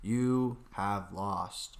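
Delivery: very slow, low, imitated film delivery; ponderous, bland villain-beating line, word by word; bland movie line. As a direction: drag it out low and lifeless. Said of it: "like, so bland and forgettable".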